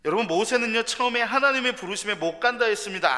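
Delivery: high, loud impassioned preaching; preaching at full force. Real, not acted. Preacher pleading with the congregation, full-force preaching. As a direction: drive it home to the congregation.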